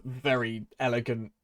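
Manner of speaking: ordinary talk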